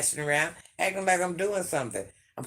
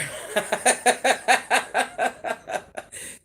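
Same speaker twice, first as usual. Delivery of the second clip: real breathy cackling laugh; burst of laughter; 8 semitones higher, 4 dB louder than usual. Real, not acted. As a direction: laugh long in a string of high bursts. Amused by chupacabra suggestion.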